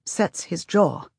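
ordinary delivery

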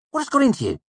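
very high-pitched exasperated question. A narrator's reading. Sim cuts off Manet's mockery, exasperated. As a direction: scold a friend, voice shooting up.

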